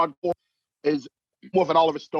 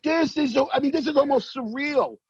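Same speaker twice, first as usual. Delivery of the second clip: awestruck; 9 semitones higher than usual. Retold or imitated. Awed inner thought quote.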